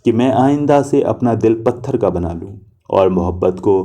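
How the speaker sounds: plain reading voice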